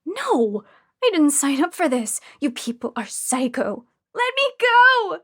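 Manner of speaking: from loud refusal to a very high shrieked let me go; terrified patient screaming at captors. A narrator's reading. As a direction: scream to be released, building. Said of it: "I screamed".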